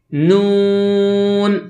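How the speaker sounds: نون with an oral vowel, held very long; from the mouth. Shown as a demonstration. Teacher showing the right way, oral drawn-out نون. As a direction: keep نون out of the nose and stretch it. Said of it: "ایک مرتبہ پھر پڑھتے ہیں".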